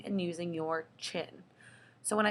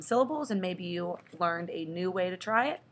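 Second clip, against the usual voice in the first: normal talking voice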